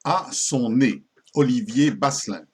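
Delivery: ordinary talk, nothing marked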